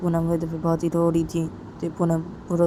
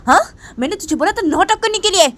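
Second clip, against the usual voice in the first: high, loud furious scolding; angry scolding; 11 semitones higher, 9 dB louder than usual. Acted. Piyush angrily scolding Sonam over the phone. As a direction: tear into her, rising in fury. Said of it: "Piyush वो सोनम के ऊपर गुस्सा कर रहा था".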